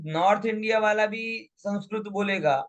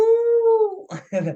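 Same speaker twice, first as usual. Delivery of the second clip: laugh; 14 semitones higher, 6 dB louder than usual. Real, not acted. Laughing after rooster imitation.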